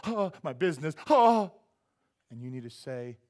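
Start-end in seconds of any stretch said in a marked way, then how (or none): 0.0-1.5 mock panic gasp. Preacher acting a worried believer suddenly panicking. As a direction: gasp then louder, higher panicked oh. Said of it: "mock panic over worries".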